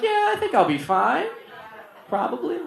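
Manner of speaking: high, small, uncertain reply; mock-brave high voice; unconvincing reassurance. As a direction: pitch it way up as if not sure at all. Teacher unconvincingly reassuring the principal.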